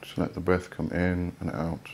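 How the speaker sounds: soft, unhurried voice with long pauses; guided meditation. Real, not acted. Host guiding listeners to let each body part relax, soft meditation voice. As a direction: ease them into relaxation, softly, phrase by phrase.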